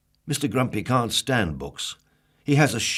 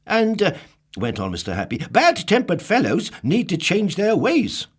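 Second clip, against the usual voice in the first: very high, loud cheerful lecture; high, loud jolly advice; chirpy Mister Happy; 10 semitones higher, 7 dB louder than usual. Acted. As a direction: preach it brightly.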